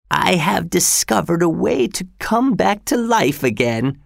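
put-on old father voice. A narrator's reading. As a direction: voice the frail father, rising.

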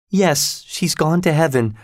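high, mildly tearful answer; through tears. A narrator's reading. Servant tells of her mistress's death, through tears. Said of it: "I answered through my tears".

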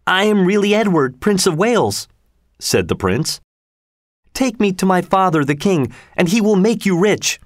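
put-on a boy insisting he is royal; the prince begging to be taken to the king; insistent prince.

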